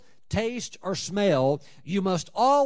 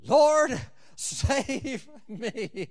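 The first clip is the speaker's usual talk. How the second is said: desperate cry laughing; 7 semitones higher, much slower than usual. Pretend. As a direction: Lord shouted high, breaking into laughter on save me. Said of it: "Get right to the point".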